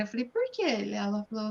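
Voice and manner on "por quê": surprised. Retold or imitated. Retold puzzled question.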